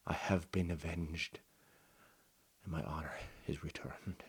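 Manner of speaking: soft, low, fading to a whisper; quiet resolve. Acted. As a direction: let go of the anger softly. Knight renounces revenge.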